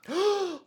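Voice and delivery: high, acted gasp of horror; shocked gasp. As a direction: gasp in mock alarm. Acts shock at healing enemy.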